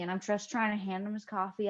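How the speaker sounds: ordinary talk, nothing marked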